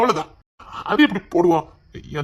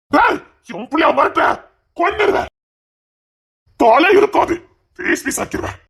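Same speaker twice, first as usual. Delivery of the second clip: furious threat; 7 semitones higher than usual. Acted. Father threatening daughter's father.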